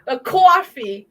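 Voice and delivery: drawn-out New York "coffee"; New York accent. Shown as a demonstration. Guest trying another word for friends, New York accent. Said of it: "the New York accents".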